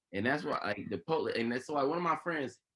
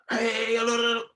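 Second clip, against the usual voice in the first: retold calling out; 7 semitones higher, 4 dB louder than usual. Onlookers calling out at arrest.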